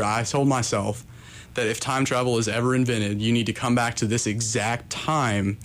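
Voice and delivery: normal talking voice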